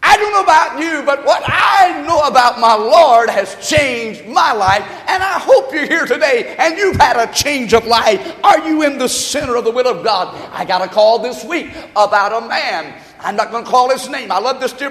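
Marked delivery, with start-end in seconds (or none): 0.0-10.3 loud, raised, urgent pulpit appeal; full-force preaching. Real, not acted. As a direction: preach it hard at the congregation.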